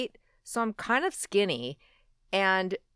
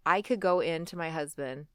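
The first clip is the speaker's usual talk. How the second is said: ordinary talk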